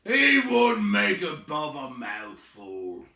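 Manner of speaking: high, loud, slow troll scoff; dismissive troll. Acted. William scoffing that Bilbo is too small, troll. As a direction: William full from supper.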